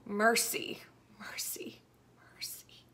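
a whisper that fades to nothing; fading whispered plea. Acted. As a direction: let each plea die into a breath. Repeated plea fading away.

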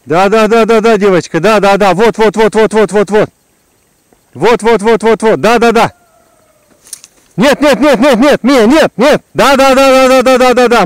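high, loud coaxing; excited dog calling. Real, not acted. Guiding dog in water.

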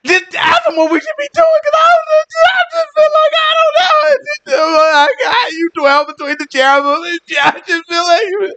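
high, frantic, rambling panic; hammed-up panic, climbing to a squeal; freaking out. King Hezekiah fearing his people will die, freaking out. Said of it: "the man freaking out".

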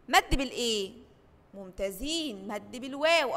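ordinary delivery

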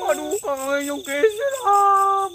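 high, drawn-out scared wail; frightened wail. Real, not acted. Partner wails to Kang about the scary pocong, frightened. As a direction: wail it high, stretching the last word.

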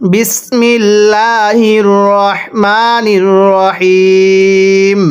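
drawn-out chanted bismillah; stretched melodic recitation; slow recitation. Acted. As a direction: intone it slowly like Quran recitation. Recites opening invocation slowly.

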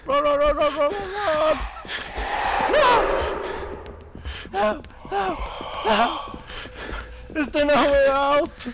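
high loud panicked yelling; being chased. Real, not acted. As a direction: scream to run while fleeing.

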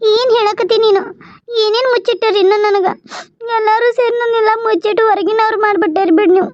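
hurt, high, weepy complaint; tearful reproach. Acted. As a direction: reproach them through tears for shutting you out. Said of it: "ಎದಕ್ ಹುಚ್ಚುಡು ಗತೆ ಮಾತಾಡಿ ಅಳಾಕತ್ತಿ?".

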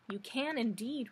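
ordinary delivery, nothing marked

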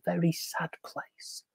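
hushed and mournful; whispering. A narrator's reading. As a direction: let it fall to a whisper. Sad story hush.

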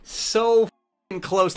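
high, loud anguished groan; agonized about missing it; raging gamer. Real, not acted. Despair at near mercy.

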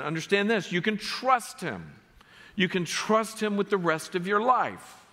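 high, ringing exhortation to rejoice; rousing preaching. Acted. Pastor urging believers to rejoice and trust God, high and rousing. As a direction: lift the congregation up, full voice.

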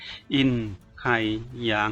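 อิน... ไห่... หยาง spaced out, each syllable apart, low and quiet; one syllable at a time, softly. A narrator's reading. Swordsman names his rival softly, syllable by syllable. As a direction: spell the name out slowly, pause between อิน, ไห่ and หยาง. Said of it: "เอ่ยออกมาทีละพยางค์เบาๆ".

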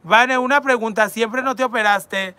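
usual speaking voice

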